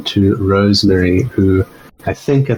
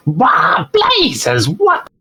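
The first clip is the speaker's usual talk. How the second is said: high-pitched goblin squawk of alarm; startled goblin cry; much slower than usual. Acted. Blasted goblin reels from losing an ear, high startled cry. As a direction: cry out in a much higher goblin voice. Game master voices the wounded goblin.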